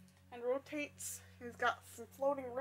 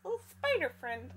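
ordinary delivery

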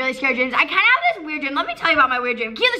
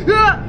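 startled scream; 6 semitones higher, 6 dB louder than usual. Retold or imitated. Startled awake in dream story.

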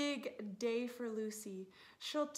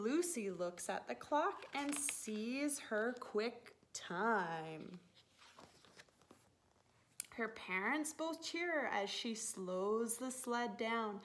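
ordinary delivery, nothing marked

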